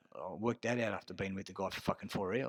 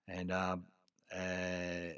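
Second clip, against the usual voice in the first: ordinary talk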